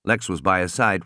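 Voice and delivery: plain reading voice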